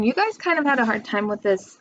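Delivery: ordinary delivery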